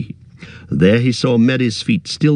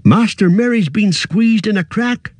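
high, urgent rustic cry; Sam's cry; 10 semitones higher than usual. Acted. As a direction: blurt it out in distress. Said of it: "cried Sam".

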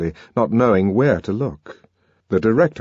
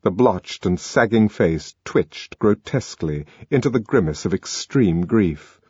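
ordinary delivery

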